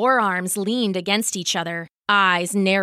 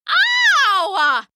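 high, loud yelp of pain; pained holler; 11 semitones higher, 6 dB louder than usual. Acted. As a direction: yell as your foot gets crushed. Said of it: "she hollered".

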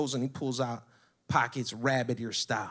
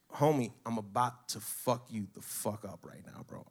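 quiet threat; 3 semitones lower, 6 dB quieter than usual. Retold or imitated. Retold threat to the suspect.

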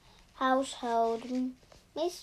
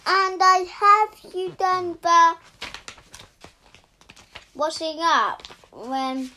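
loud complaint; 3 semitones higher, 6 dB louder than usual. Acted. Wife listing chores to husband, loud scolding. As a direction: keep hammering him with each chore. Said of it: "said loudly".

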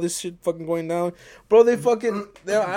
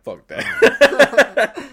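high, loud, laughing brush-off; loud dismissive retort; 10 semitones higher, 9 dB louder than usual. Retold or imitated. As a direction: toss it off like it's obviously stupid. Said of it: "and I'm like".

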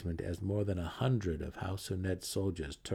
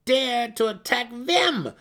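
much higher, loud, accusing daughter; angry growl; 10 dB louder than usual. Acted. Brisa snarling at her mother, loud and accusing. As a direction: throw the words back in her face. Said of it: "Brisa growled at her mother".

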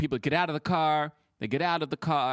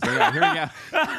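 high laughing line; 13 semitones higher, 5 dB louder, slower than usual. Real, not acted. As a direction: laugh through it. Laughing before his take.

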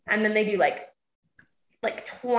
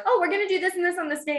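mock eager friends; 7 semitones higher, much faster than usual. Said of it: "when people are like".

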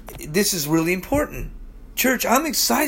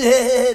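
short put-on snicker; mock laughter; 5 semitones higher, 5 dB louder than usual. Preacher imitating unbelievers laughing, brief mock laugh. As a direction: imitate a scoffer's quick he-he laugh.

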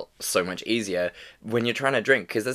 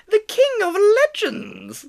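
very high, louder goblin squeak; tiny goblin proclaiming grandly; 7 dB louder than usual. Pretend. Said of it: "I love it so much".

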